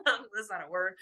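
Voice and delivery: giggling at own slip; amused little giggle; laughing at herself. Real, not acted. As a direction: laugh through the words. Laughs at own made-up word.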